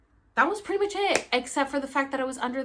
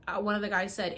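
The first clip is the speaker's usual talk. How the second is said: normal talking voice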